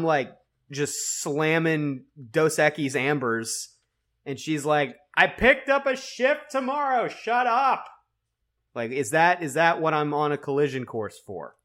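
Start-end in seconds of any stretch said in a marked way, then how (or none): 5.1-7.8 put-on high South African accent, girlfriend voice. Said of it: "a South African accent just now".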